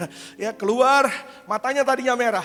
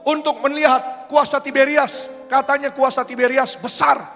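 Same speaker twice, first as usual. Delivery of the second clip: higher, louder, challenging; defiant boast; 5 semitones higher, 6 dB louder than usual. Retold or imitated. Makassar man brags to the baptism team, defiant and loud. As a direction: throw down the challenge to the church.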